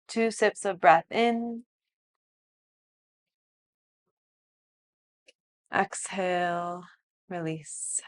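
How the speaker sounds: soft, slow meditation voice; guided meditation. Acted. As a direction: guide them gently into stillness. Leads breathwork and relaxation.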